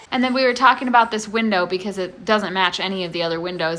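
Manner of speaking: normal talking voice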